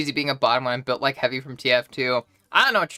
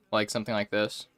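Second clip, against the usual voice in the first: demonstrating former voice, lower and quieter; old speaking voice; 4 semitones lower, 6 dB quieter than usual. Shown as a demonstration. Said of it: "much more similar to how I used to speak".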